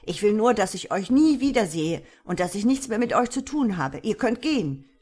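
loud, insistent dismissal; angry bellow. A narrator's reading. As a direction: angrily send him packing. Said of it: "brüllte Tom ihn an".

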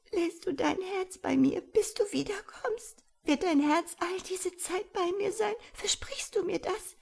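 high tearful whisper; whispering through sobs. A narrator's reading. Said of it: "wisperte unter Schluchzen".

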